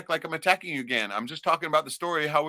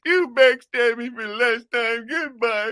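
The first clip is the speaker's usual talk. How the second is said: high, slow, huffy farewell; imitating the offended commenter storming off; mock offended guy; 7 semitones higher, 3 dB louder, slower than usual. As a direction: drag out the wounded goodbye. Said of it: "he goes".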